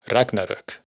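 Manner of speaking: "Ragnarök" stressed on RAG; first-syllable stress. Shown as a demonstration. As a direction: hit the first syllable of "Ragnarök", let the rest fall. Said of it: "se pronuncia con el acento en la primera sílaba".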